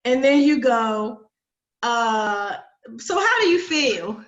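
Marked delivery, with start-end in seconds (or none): 3.0-4.2 slow, tactless question, pitched up; blunt question. Retold or imitated. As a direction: ask it bluntly, slowly, as if you hadn't listened. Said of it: "a good way to get cussed out".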